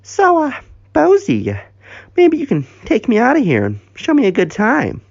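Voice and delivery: high-pitched coy voice; flirty come-on. Acted. Crystal propositioning Bozo, flirty. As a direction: coo the invitation high, with hesitations.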